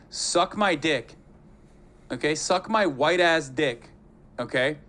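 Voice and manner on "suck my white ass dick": ordinary talk, nothing marked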